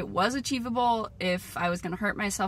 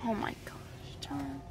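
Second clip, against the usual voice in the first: whispering; 8 semitones lower, 13 dB quieter, slower than usual. Real, not acted. Hushed aside.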